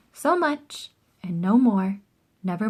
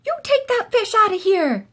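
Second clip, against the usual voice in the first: high, louder angry yell; 12 semitones higher, 5 dB louder than usual. A narrator's reading. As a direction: chase the fish out with a shout. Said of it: "they yelled".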